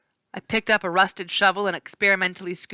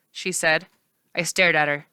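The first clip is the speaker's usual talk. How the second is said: usual speaking voice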